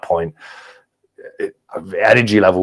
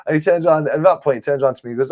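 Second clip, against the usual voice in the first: ordinary talk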